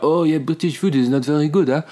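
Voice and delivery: sneering impression of the French hosts; mock French snootiness. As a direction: put on a snooty foreign tone. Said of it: "they're like".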